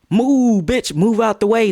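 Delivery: high, loud mock shove-off; aggressive command. Asking if the shove was meant hard, aggressive command. Acts out harder shove versus little push.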